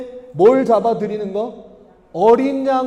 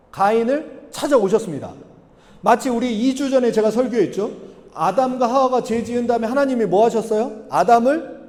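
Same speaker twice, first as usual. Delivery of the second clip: ordinary talk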